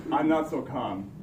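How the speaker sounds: usual speaking voice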